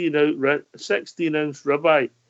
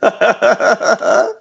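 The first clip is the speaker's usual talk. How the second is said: hearty real laugh; loud laugh; 5 semitones higher, 5 dB louder than usual. Real, not acted. Laughing at the joke.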